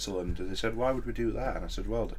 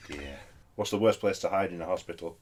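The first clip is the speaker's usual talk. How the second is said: ordinary talk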